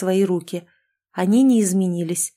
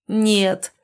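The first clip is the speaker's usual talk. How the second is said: ordinary delivery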